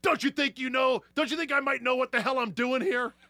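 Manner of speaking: indignant yell, high and loud; loud, high-pitched angry outburst; Tony Soprano yelling. Acted. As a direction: yell it at your wife, pitch shooting up. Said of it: "And he yells at her".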